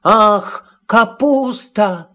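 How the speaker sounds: high, drawn-out sigh of delight, voiced; mock whisper, pitched high; Matołek whispering softly. A narrator's reading. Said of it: "szepnął cicho".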